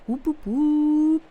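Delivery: high, loud, drawn-out whooping; celebratory whoop. Pretend. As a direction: whoop it up slowly for a new sub. Celebrating a subscriber.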